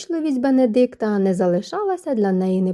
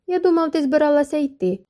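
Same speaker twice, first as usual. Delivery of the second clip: mildly mocking, pitched higher; 6 semitones higher than usual. A narrator's reading. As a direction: tease her lightly, starting high and falling. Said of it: "глузливо промовив він". (злегка глузливо)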